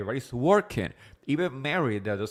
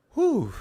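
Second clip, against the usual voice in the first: high whew; 6 dB louder than usual. Real, not acted. Big exhale before new topic.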